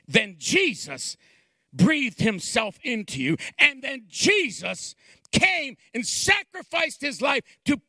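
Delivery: building from plain talk to high, loud, shouted preaching; full-force preaching. Acted. Pastor urging the congregation toward salvation, preaching at full force. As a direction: climb into a fiery altar-call shout.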